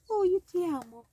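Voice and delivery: very high, put-on lovey voice; silly put-on voice. Teacher reading a love lyric to learners, silly voice. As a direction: pitch it way up, playfully silly. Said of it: "I don't know why I did that voice".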